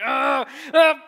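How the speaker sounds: loud, high mock tantrum cries; imitated angry grunts. Dad imitating daughter before she throws Legos, angry cries. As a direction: shout out a child's wordless frustration. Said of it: "she was getting angry".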